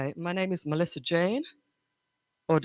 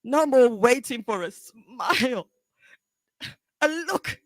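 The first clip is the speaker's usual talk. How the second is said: higher-pitched, halting confrontation with long pauses; anguished, accusing daughter; 13 semitones higher than usual. Acted. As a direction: confront Mom, close to tears, with bursts of force. Audition monologue, daughter confronting mother.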